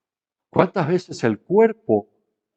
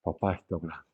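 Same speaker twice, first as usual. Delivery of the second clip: very soft whispered shh, barely voiced; a mother's quiet hush; 8 semitones lower, 14 dB quieter than usual. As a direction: whisper it so papá isn't disturbed. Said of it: "Pero yo sé el".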